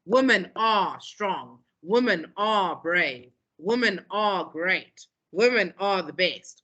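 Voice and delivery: each line slow, leaning hard on women; slow, emphatic repetition. Shown as a demonstration. Teacher reading a protest poem to the class, slow and emphatic. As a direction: drive the point home line by line. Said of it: "if you're writing a poem about women's rights and you say".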